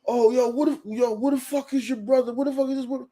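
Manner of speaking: high, agitated imitation of an angry boss; worked-up but not shouted; mock spazzing. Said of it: "he was spazzing and all that".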